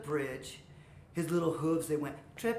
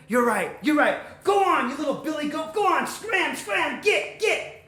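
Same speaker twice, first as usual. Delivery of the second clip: loud, high troll shooing; gruff dismissal; 8 semitones higher, 6 dB louder than usual. Acted. Troll sending little goat away, shouting. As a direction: shout him off impatiently.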